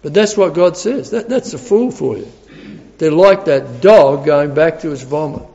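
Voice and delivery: ordinary talk, nothing marked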